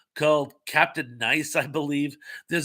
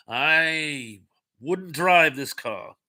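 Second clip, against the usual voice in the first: very slow, drawn-out British actor impression; Alec Guinness; much slower than usual. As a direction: stretch the warning out like a grand actor. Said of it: "Sir Alec Guinness".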